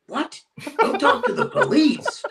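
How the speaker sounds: high-pitched retold disbelief with a laugh; incredulous echo. Renter repeats the host's police warning, incredulous. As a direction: squeak the warning back in mock astonishment.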